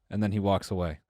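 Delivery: normal talking voice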